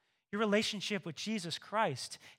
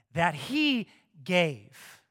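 preaching emphasis; 2 semitones lower, 7 dB louder, much slower than usual. Acted. Slow emphatic climax.

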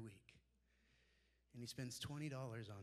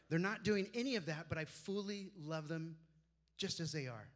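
emphatic preaching; 3 semitones higher, 8 dB louder than usual. Real, not acted. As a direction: proclaim it with force, rising. Preacher stressing unconditional love.

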